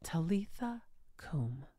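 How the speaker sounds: tender whispered calling; whispered Aramaic. Acted. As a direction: breathe the words close to her ear. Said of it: "It was a private, compassionate moment".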